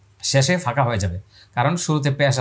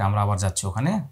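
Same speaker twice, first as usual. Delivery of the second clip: normal talking voice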